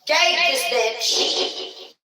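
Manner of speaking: high, loud, drawn-out yell; yelled quote, stretched out; mock yell. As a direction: holler it out slowly in mock outrage.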